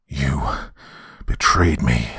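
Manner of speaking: understated anger, low and deliberate; a mild, weighty accusation; angry shout. A narrator's reading. Betrayed man confronting his friend, angry. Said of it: "I angrily shouted".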